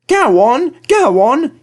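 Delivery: high, loud, pushy engine puffing; eager engine pushing ahead; James puffing. A narrator's reading. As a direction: urge him on, loud and high. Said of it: "he puffed to Edward".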